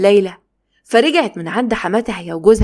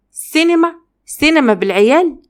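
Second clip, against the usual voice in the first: سينما flung up much higher in disbelief; incredulous echo; 7 semitones higher, 3 dB louder than usual. Acted. Wife echoing her husband's cinema plan, much higher and incredulous. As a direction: scoff at the very idea.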